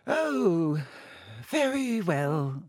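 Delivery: put-on high, very slow wheezy old teacher; Professor Binns, slowly. A narrator's reading. Teacher thrown by the show of interest, slow and wavering. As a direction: give in with long drawn-out words. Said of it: "he said slowly".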